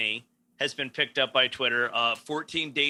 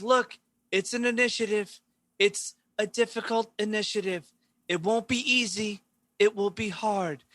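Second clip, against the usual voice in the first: higher, deliberate politician voice, restating the point; Andrew Cuomo impression; 6 semitones higher than usual. Showing Chris how Cuomo talks at press conferences, higher mock politician voice. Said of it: "this is how he talks".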